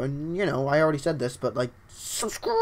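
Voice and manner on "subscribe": drawn-out, strained croak; weird strained voice. Pretend. Streamer begging viewers to subscribe, weird strained voice. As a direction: stretch the word into a weird strangled cry. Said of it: "That sounded really weird".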